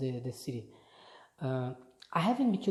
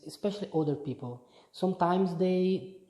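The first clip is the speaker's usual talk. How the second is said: normal talking voice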